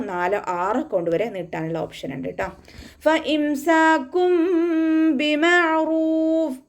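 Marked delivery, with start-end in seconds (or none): 3.1-6.6 very slow, high melodic recitation; Quran recitation. Shown as a demonstration. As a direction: chant it like a tajweed teacher modelling the verse. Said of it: "ഫ light ആക്കിയിട്ട് ഉച്ചരിക്കുക".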